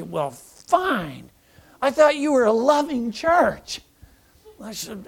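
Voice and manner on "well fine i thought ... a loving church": huffy, the last line higher and louder; a hurt, indignant comeback; offended retort. Retold or imitated. Dog owner huffily snapping back at the pastor.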